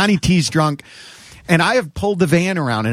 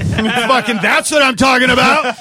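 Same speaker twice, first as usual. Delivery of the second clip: high, loud whoop; hyped shout; 7 semitones higher, 4 dB louder than usual. Retold or imitated. The drunk him rallying guys for a road trip, hyped shout. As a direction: cheer the crowd on.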